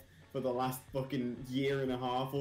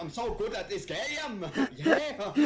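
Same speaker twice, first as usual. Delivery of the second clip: high mock English-gone-German accent; mimicked bad English accent; 7 semitones higher, 4 dB louder, slower than usual. Friend mimics streamer's attempt, bad English accent. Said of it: "someone who's moved over from Germany".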